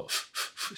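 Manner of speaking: unvoiced "フッ" puffs of air; F sound. Shown as a demonstration. Teacher demonstrating the F sound, short voiceless puffs. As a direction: blow short F bursts on "フッ". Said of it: "Fの音".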